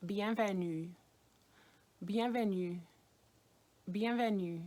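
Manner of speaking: bienvenue, nasal vowel, stress on the last syllable; French bienvenue. Shown as a demonstration. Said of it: "how to say welcome in French".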